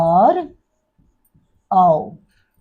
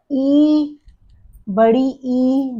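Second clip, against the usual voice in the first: the vowel ई drawn out slowly; long ee demo; 4 semitones higher, much slower than usual. Shown as a demonstration. Teacher showing a long vowel to pupils, drawn out slowly. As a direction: stretch बड़ी ई slowly for the class. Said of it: "दीर्घई जिसको कहते हैं".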